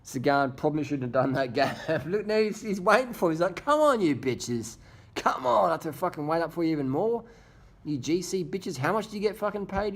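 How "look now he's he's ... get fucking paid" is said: high-pitched goading, as a rider to rivals; hammed-up impatient heckling; excited taunting. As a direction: voice pitched up, mock exasperated.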